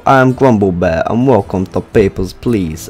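put-on loud, hammy Eastern European accent. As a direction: announce the game in a fake Eastern European accent. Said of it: "this terrible accent".

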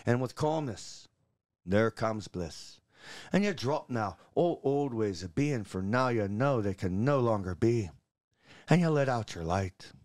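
lilting Scottish bard reciting a blessing; put-on Scottish poetry recital; Scottish accent. Shown as a demonstration. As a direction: recite it in a Scottish accent, savouring each rhyme. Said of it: "it sounds better in a Scottish accent".

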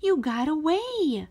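he can't believe she got out; excited boy. A narrator's reading. Boy to his runaway dog, amazed and excited. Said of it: "he said".

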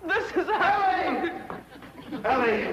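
high, slow, laughing lovestruck cry; smitten swoon. Acted. Lovestruck suitor calls Ellie's name, giddy high swoon.

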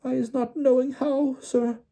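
very high, squeaky frightened elf; squeaky, halting protest; Winky gasping. A narrator's reading. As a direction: stammer it out in a tiny high squeak. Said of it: "Winky gasped".

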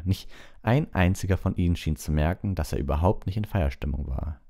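usual speaking voice

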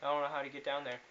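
usual speaking voice

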